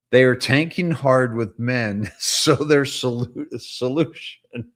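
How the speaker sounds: reading through real giggles, slowing; laughing while reading. Real, not acted. Rereading a post to viewers, breaking into laughter. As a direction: try to read it but crack up laughing. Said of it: "I can't keep a straight face".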